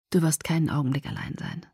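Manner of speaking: whispered, soft promise. Acted. Edward promising Bella on the drive, whispered. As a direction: reassure her under your breath. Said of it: "versprach Edward".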